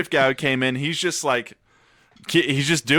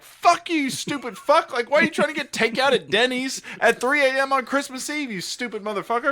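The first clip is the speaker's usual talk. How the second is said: much higher, hammed-up yelling at the caller; mock screaming; 12 semitones higher than usual. Imitating the dishwasher screaming at a caller, high mock yell. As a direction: imitate her screaming insults, pitched way up. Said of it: "She's just screaming like".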